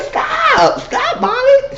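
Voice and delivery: very high mock squeal; squealed protest. Imitating a woman as Molly tries taking her wig, squealed protest. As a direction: imitate a high, whiny plea to quit.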